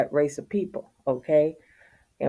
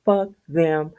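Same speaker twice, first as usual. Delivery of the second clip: laughed out, high and breathless; laughing. Real, not acted. Vlogger laughing off rude neighbors. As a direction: laugh right through the words.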